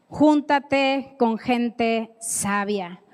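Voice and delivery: slow emphasis. Shown as a demonstration. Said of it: "slow emphatic teaching".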